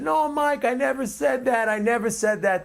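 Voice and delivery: lightly voiced indignant denial; mock denial. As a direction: imitate him insisting he never said it. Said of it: "how he said".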